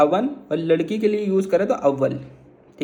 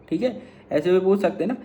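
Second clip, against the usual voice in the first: normal talking voice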